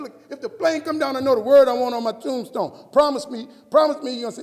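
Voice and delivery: high, booming comic insistence; loud insistence. Retold or imitated. Husband making his wife promise, loud and urgent. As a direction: press for a promise at full volume. Retold pleading with wife over tombstone.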